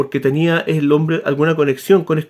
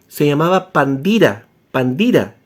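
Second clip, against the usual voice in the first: "Pandira" said firmly, repeated lower; stress on Pandira; 3 semitones lower than usual. Shown as a demonstration. Correcting the name for his audience, insisting on Pandira. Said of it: "Pero la palabra era Pandira".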